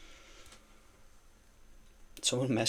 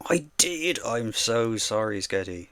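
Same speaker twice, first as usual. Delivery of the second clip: loud, drawn-out sorry; loud apology; 2 semitones higher, 9 dB louder, slower than usual. Real, not acted. Streamer loudly apologising to a viewer he missed. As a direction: call out the apology big.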